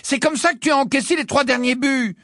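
voix aiguë de capitaine sévère; reprends-le en capitaine exaspérée; capitaine exigeante. Acted. Angelina donne des consignes. (capitaine qui recadre son équipe)

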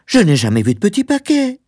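very high, fretful woman's voice; high, exclaimed denial; protesting wife. Acted. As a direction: protest it in a high-pitched, agitated voice. Wife protests about the parcel.

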